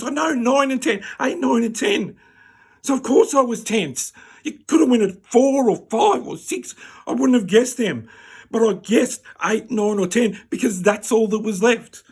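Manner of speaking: high, loud, aggrieved rant; outraged yelling. Retold or imitated. Patient berating doctor over the count, outraged yelling. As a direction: keep shouting your protest, every phrase pushed up.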